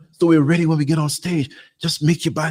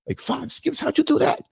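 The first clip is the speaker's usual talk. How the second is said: put-on amazed kid voice; 11 semitones higher, 6 dB quieter than usual. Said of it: "kids amazed at skipping rock".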